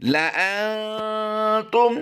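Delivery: लअंतुम chanted very slowly and high; Quranic recitation. Shown as a demonstration. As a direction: stretch लअंतुम out in a melodic chant. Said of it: "आइए इसकी अदायगी फिर कर लेते हैं".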